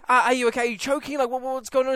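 frantic worry over a stranger; panicked. Retold or imitated. Promo girl asking collapsed man if he's okay, panicked.

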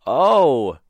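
loud, drawn-out "oh" of dismay; dismayed realisation. Retold or imitated. Dad opening the hoop box at night, dismayed. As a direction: let the bad discovery land loudly. Opens box, finds the base missing.

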